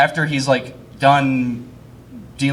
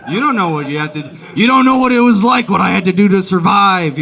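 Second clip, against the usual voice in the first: defensive shout, building louder and higher; raised, high-pitched veteran's outburst; outburst as war veteran; 4 semitones higher, 7 dB louder than usual. Acted. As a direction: explode like a haunted veteran. Acting traumatized war veteran grandpa.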